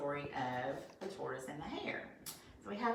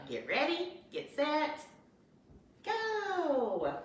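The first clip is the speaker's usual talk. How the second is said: a mild mock shout climbing higher to go; shouting start call; 9 semitones higher, much slower than usual. A narrator's reading. Fox starting the race for all the animals, mild mock shout rising higher. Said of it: "then he shouted".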